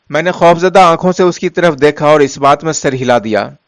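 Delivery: ordinary delivery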